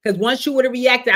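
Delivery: ordinary talk, nothing marked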